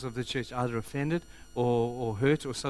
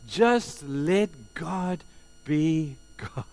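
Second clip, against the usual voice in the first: slow, weighty, raised delivery; emphatic cry; 4 semitones higher, 3 dB louder, much slower than usual. Retold or imitated. Job silencing his accusers, slow emphatic cry. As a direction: space out each word with force.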